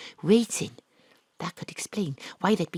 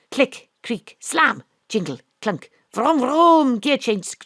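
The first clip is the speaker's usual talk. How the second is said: spoken sound effects; 6 semitones higher, 8 dB louder, slower than usual. Acted. Vocal car sound effects.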